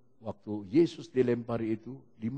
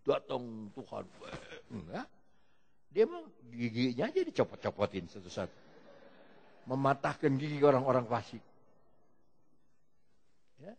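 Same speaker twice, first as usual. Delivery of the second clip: ordinary talk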